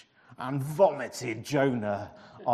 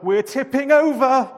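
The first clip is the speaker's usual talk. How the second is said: high, loud alarmed voice; panicked sailor's cry; frantic sailor; 11 semitones higher, 14 dB louder than usual. A narrator's reading. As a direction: yell it as the deck tilts. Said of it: "cried another".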